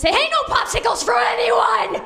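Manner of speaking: high mock shout at the kids; mom shouting. Sister shouting at her kids, high mock shout. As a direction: bark the rule across the room. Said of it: "if they're shouting it".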